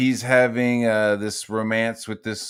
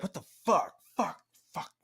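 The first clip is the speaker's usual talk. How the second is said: self-directed cursing; 6 semitones higher, 9 dB quieter than usual. Retold or imitated. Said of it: "I get really gnarly with myself".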